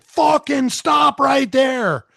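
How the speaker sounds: slow, high, loud yelled command; retold angry yell. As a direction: bark it out like an enraged golfer. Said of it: "screaming at me at the top of his lungs".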